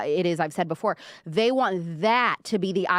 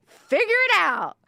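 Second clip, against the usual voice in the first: mock command; 11 semitones higher, 4 dB louder than usual. Mocking producers' careless instruction.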